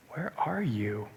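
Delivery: a soft whispered question from God; gentle whisper. Retold or imitated. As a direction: breathe it gently, as God calling to Adam. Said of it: "gently take them".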